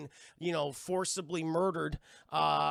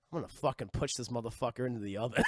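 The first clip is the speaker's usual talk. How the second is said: low, fast sinister mock voice; low villainous muttered plan, played for laughs; scheming killer chair; 8 semitones lower, faster than usual. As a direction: drop low like a plotting villain. Voicing the murderous chair's thoughts.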